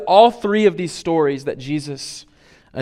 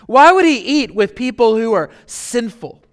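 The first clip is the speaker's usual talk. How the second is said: lightly acted, higher-pitched grumble of disapproval; mock grumbling; 3 semitones higher than usual. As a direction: grumble in mild disbelief, as the scandalized critics. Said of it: "they're grumbling about it and saying".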